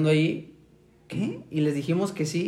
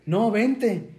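retold call; 6 semitones higher than usual. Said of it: "le dije".

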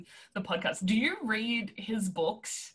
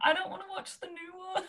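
mock whiny reluctance; 10 semitones higher, 5 dB quieter than usual. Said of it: "so I'm just like".

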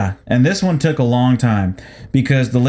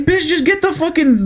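exasperated outburst; 14 semitones higher than usual. Retold or imitated. Exasperated inner rant.

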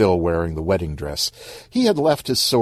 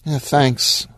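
mildly glum, raised character voice; 7 semitones higher than usual. Acted. Stan thanks Monique for the compliment, glumly. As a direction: give thanks with a touch of gloom. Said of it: "said Stan glumly".

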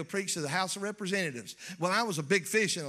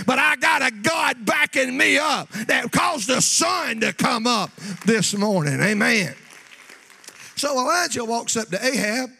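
loud, rising preacher's cry to the church; preaching at full force; 3 semitones higher, 10 dB louder than usual. Acted. Preacher to his congregation, loud building full-force preaching. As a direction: preach it out loud, whipping up the crowd.

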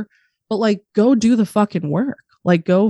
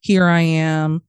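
usual speaking voice

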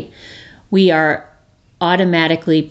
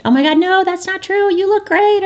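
much higher, breathless brush-off; gushing denial. Retold or imitated. Mocking a friend who negates a friend's pain, high and gushing. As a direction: shoot the feeling down with sugary praise. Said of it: "Negate it".

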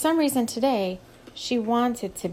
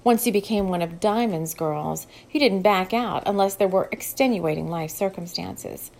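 ordinary delivery

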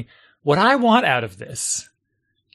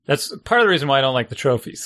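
ordinary talk, nothing marked

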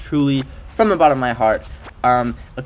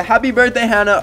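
louder, higher sing-song; excited birthday greeting; 11 semitones higher, 7 dB louder than usual. Acted. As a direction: cheer it out for her. Greeting a viewer cheerfully.

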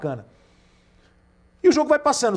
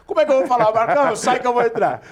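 high, loud mock quote of a friend; quoted friend; 8 semitones higher, 6 dB louder than usual. Friend retelling goalkeepers' lineup, raised playful voice. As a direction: raise the voice playfully, quoting him. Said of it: "Ele falou".

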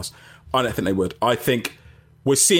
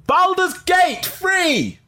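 excited drawn-out read; much slower than usual. Real, not acted. Reading viewer's comment.

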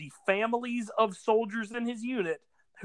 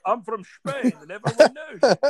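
laughing through a put-on Scottish accent; mock Scottish accent; 5 semitones higher, 5 dB louder than usual. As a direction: do Sean Connery's Scottish accent claiming he's from Spain, laughing. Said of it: "talking in a Scottish accent".

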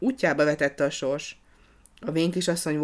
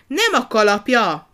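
much higher, louder, slow correction; exasperated shout; 7 semitones higher, 9 dB louder than usual. A narrator's reading. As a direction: correct him at full volume, losing patience. Frici corrects the porter, exasperated.

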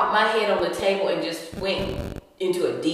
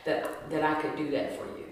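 softer, lower, self-satisfied; mock the doctor's pious pride; lightly voiced smug doctor; pious proud doctor; 5 semitones lower, 7 dB quieter than usual. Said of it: "pious manner and so proud".

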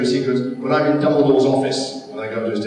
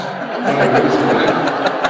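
laugh. Real, not acted. Laughing at the risqué story.

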